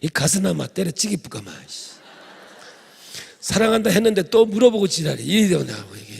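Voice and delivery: loud, angry Gyeongsang dialect rant; angry Gyeongsang husband. Retold or imitated. Gyeongsang husband exploding at his wife, loud and angry. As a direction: blow up at her in Gyeongsang dialect. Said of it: "경상도 이래".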